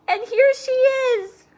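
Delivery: put-on very high, loud, slow baby bear cry; wee small voice. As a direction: squeal it out in surprise. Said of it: "in his wee small voice".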